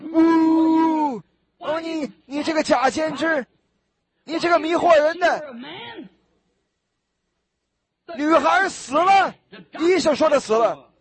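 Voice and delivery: shouted mockery; mocking jeer. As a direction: jeer at him like a scoffing crowd. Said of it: "他们就耻笑他".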